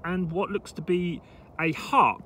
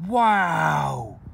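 surprised gasp; 4 semitones higher, 6 dB louder than usual. Real, not acted. Reacts to coin date.

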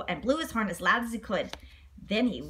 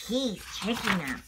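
plain reading voice

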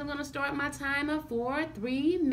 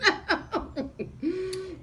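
bright laugh; 8 semitones higher than usual. Real, not acted. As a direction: break into a laugh. Laughs at the bad food.

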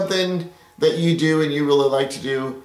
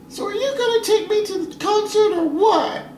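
put-on much higher, nagging girlfriend voice. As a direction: play the impatient girlfriend, high up. Girlfriend voice asking about concert.

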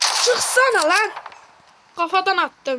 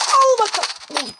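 taunting; 5 semitones higher, 5 dB louder, much slower than usual. Real, not acted. Shooting an enemy.

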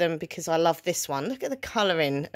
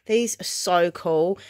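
usual speaking voice

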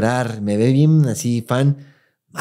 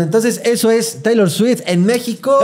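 show sign-off; 8 semitones higher than usual. Acted. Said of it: "podcast sign-off".

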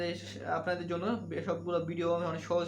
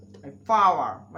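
power drawn out very slowly as paa, louder; very slow, loud British demo of my power; slow drill; 3 semitones lower, 8 dB louder, much slower than usual. Shown as a demonstration. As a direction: stretch my power out slowly and loudly. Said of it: "আমার ক্ষমতা".

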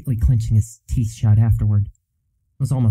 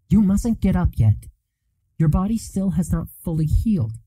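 higher, chiding nurse voice; gentle chiding; 8 semitones higher than usual. Acted. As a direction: scold gently, pitched up. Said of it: "she chided".

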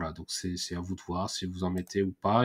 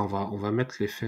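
ordinary talk, nothing marked

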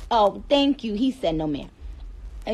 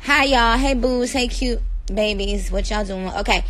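ordinary delivery

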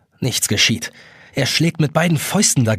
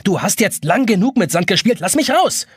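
raised voice climbing to a high cry; getting angry; 7 semitones higher than usual. A narrator's reading. Jonas demanding the sand clock free him, rising anger. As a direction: demand release, building in anger. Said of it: "Jonas wird langsam böse".

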